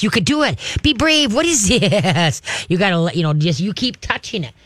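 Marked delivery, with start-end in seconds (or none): 0.0-1.3 much higher, coaxing cheer; bright rising encouragement; cheerleader encouragement. Shown as a demonstration. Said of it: "you gotta be the cheerleader". 1.3-2.4 giggly, amused wondering; playful laugh to tempt the dog closer; laughing curious. Shown as a demonstration. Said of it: "you gotta laugh with your voice".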